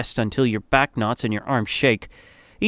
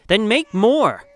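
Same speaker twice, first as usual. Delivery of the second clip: higher, demanding midwife; angry order; 6 semitones higher, slower than usual. A narrator's reading. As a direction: order her with mild anger. Midwife slaps table and orders.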